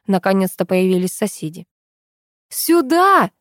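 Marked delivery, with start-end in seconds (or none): 2.5-3.3 high-pitched cry for help; urgent, raised-voice summons; desperate yell. A narrator's reading. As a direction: call out as if yelling with all your might. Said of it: "что есть мочи, завопила я".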